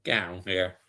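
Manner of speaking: talking with food in mouth; words through a mouthful; mouth full. Real, not acted. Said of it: "Sorry, I had something in my mouth".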